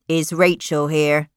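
a hint of a menacing young man; lightly voiced intruder at the door; young man asking. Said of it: "he asked".

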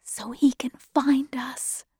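hushed, near whisper; low voice. A narrator's reading. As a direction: confide it very softly. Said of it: "I said, my voice low".

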